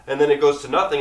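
ordinary talk, nothing marked

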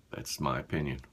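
ordinary talk, nothing marked

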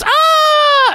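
very high puppet moan; mock ecstatic squeal as Elmo; Elmo climax cry. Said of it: "and then goes".

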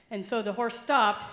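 ordinary delivery

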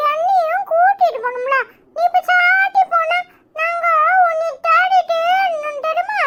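put-on very high, drawn-out child voice; complaining little child. Child to mother who went out alone, plaintive complaint. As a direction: whine like a small child left behind.

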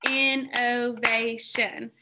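"Innovation" said very slowly, in-no-va-tion, each syllable apart; syllables clapped out. Shown as a demonstration. Teacher clapping syllables with the class, very slow. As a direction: pace "Innovation" one syllable per clap. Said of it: "Clap those syllables out for me".

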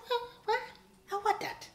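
put-on very high, loud shocked old lady; grandmother. On the phone with the stylist, a grandmother's shocked squeal. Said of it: "I can hear my grandmother saying that".

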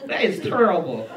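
lightly voiced old lady, loud; a hint of comic old-lady disapproval.

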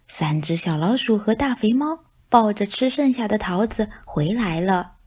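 ordinary delivery, nothing marked

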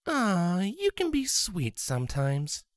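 put-on sweet young mare. A narrator's reading. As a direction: tease him gently with affection.

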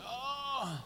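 high, soft mock yawn; imitated yawn. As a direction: mimic a yawn on a drawn-out "oh". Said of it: "You just act like you were yawning".

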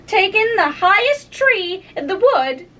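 high-pitched, exasperated bird voice; plaintive pigeon listing grievances; fretful pigeon. Acted. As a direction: complain like a harried mother bird.

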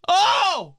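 high, loud scream of "oh"; sudden anguished shriek; exasperated scream. Real, not acted. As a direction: yelp in horror, very high. Screaming at the mispronounced house.

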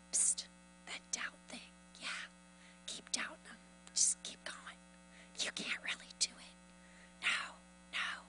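insinuating whisper; whispering spirit. Acted. Spirit whispering in a doubter's ear, hushed coaxing. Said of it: "they start whispering in your ear".

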